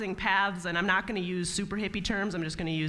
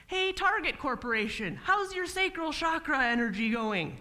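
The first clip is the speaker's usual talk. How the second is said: higher, eager mock pitch of chakra talk; hippie pitch to a client; 7 semitones higher than usual. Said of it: "Uh, what?".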